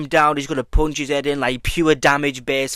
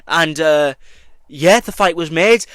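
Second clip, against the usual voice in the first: usual speaking voice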